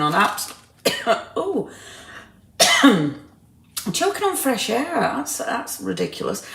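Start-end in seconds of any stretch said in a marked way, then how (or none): 1.1-4.9 coughing while talking. Real, not acted. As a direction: speak through a cough.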